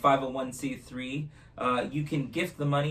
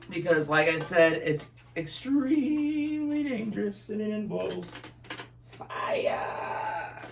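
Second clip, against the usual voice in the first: put-on kids party magician; 8 semitones higher, 4 dB louder than usual. Magician hypes dangerous trick.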